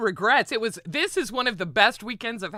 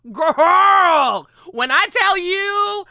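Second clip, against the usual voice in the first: much higher and louder, gushing; excited storytelling; 11 semitones higher, 9 dB louder, slower than usual. Real, not acted. Streamer gushing to viewers about a wild dream, excited and loud. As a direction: shout it out, thrilled by the memory.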